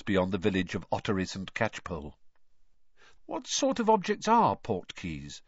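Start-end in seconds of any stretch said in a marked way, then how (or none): 3.2-5.4 higher-pitched curious question; lightly acted, inquisitive boy's question. A narrator's reading. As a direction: ask with open curiosity. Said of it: "said Harry curiously".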